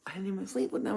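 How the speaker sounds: ordinary talk, nothing marked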